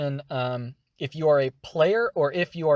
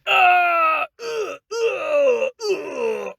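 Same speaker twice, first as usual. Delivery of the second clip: high strained grunts, easing off; constricted inner grunt; 7 dB louder, much slower than usual. Shown as a demonstration. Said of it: "You wanna mimic that feeling".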